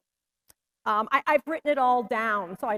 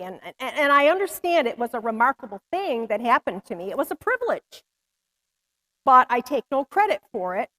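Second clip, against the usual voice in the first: ordinary talk, nothing marked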